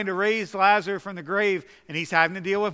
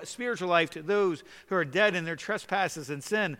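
ordinary talk, nothing marked

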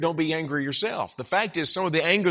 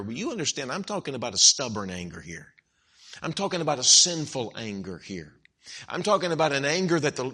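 usual speaking voice

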